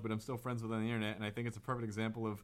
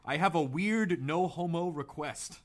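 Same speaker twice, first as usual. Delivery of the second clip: slow; 4 semitones higher, 3 dB louder, much slower than usual. Real, not acted. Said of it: "this is bizarre".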